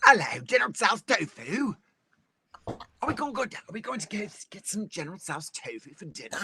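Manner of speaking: high-pitched comic Cockney; playful mock Cockney; Cockney accent. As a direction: put on a squeaky, hammed-up Cockney. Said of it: "now say it with a Cockney accent".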